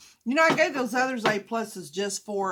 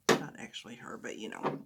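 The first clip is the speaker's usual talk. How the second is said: whisper; 11 semitones lower, 8 dB quieter than usual. Real, not acted. Aside about the earrings.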